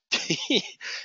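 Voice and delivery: airless, voiceless chuckle; silent laugh. Real, not acted. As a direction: laugh with no voice at the nasty post. Laughs at the nasty post.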